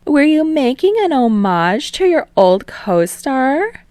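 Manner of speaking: put-on playful TV host calming the boys, then prying; teasing talk show host. Host Utsushimi probing.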